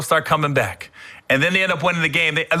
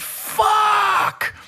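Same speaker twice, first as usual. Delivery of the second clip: high cry of frustration; a pained high curse at the TV; retold anguished yell. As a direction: howl it as your quarterback goes down. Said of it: "and I went".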